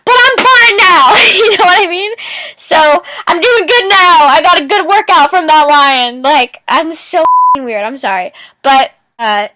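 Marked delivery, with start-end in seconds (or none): 0.0-1.1 much higher, louder sing-song; mock cheerful. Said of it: "and just be like". 1.1-6.2 high, loud, laughing while talking; laughing at her own joke. Real, not acted.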